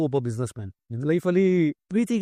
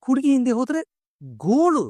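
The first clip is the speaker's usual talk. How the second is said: playful punchline; 8 semitones higher than usual. Acted. Father recites playful rhyming saying.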